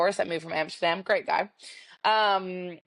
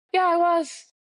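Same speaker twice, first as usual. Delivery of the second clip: awkward high reply; 11 semitones higher, 5 dB louder, slower than usual. Retold or imitated. Awkward replies to bartender.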